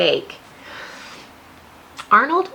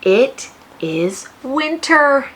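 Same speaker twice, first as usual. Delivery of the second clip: slow announcement; 3 semitones higher, 5 dB louder, much slower than usual. A narrator's reading. Announcing the new season.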